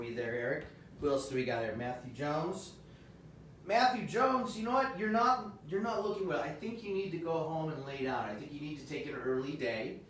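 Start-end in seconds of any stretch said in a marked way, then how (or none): 3.7-7.8 mock concern.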